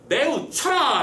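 high, drawn-out order to the floggers; lightly acted official's command. Disguised official orders the flogging, mock commanding. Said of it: "라고 시키죠".

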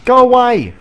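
high, loud yell to shoo it off; shouting. Real, not acted. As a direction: shout at the creature to leave. Yelling at game creature.

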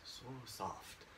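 soft drop to near silence; hushed echo. Shown as a demonstration. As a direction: breathe the words softly. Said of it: "Her skin is so soft".

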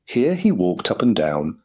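usual speaking voice

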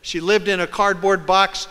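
usual speaking voice

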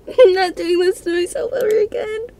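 high, tearful whimper; terrified gamer swearing off horror, crying high. Real, not acted. Terrified player, crying sound.

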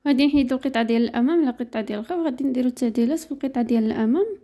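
normal talking voice

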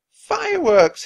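put-on very high, loud thrilled child cry; excited cry. A narrator's reading. Little boy sees the rocket burst, thrilled. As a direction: squeal with wonder at the rocket.

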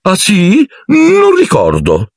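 very high, drawn-out feigned forgetfulness; flustered evasion. A narrator's reading. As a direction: stall in a squeaky high voice. Lockhart messo alle strette, finge. (tergiversando, con voce acutissima)

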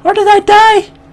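high, loud raging yell at the screen; furious shout. Real, not acted. Gamer just killed, furious high yell. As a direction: scream it out in real fury. Player dies suddenly in game.

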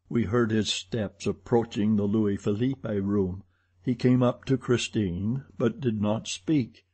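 ordinary delivery, nothing marked

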